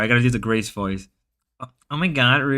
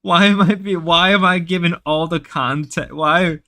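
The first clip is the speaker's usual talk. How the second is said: mock outrage; 5 semitones higher, 4 dB louder than usual. Mock complaint to chat.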